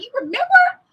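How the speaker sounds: high, loud, thrilled question; high delighted squeal; delighted surprise. Retold or imitated. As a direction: squeal it, touched he remembered. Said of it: "And I thought".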